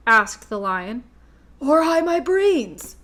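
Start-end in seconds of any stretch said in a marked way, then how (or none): 1.6-3.0 high, slow tearful wail. A narrator's reading. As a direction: wail it out, near tears. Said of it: "wailed the Scarecrow".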